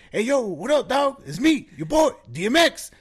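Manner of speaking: high, loud, rough lookalike hustler. Retold or imitated. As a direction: imitate a scrappy rapper soundalike hailing a friend. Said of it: "perfect impression".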